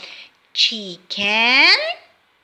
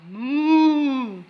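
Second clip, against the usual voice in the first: cow moo. Acted. Animal sound for kids.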